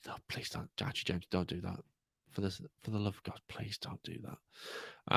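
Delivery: hushed, half-whispered mock plea; mock pleading. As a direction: beg under your breath, playfully. Mock plea against doing the accent.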